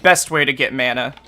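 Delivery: ordinary delivery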